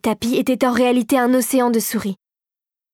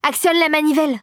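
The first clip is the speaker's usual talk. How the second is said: raised, firm command; commanding order; 5 semitones higher than usual. A narrator's reading. Clara orders the captain to crank it, commanding. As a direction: give it as an order, a little higher. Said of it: "lui ordonna-t-elle".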